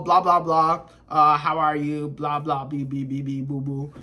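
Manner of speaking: mock their small talk, trailing into a low mumble; bored blah-blah imitation; dismissive chatter.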